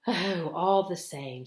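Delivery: loud, slow, choked-up words; choking voice. Acted. Peter choking up about his missing brother. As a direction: fight back the lump in your throat. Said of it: "in a rather choking sort of voice".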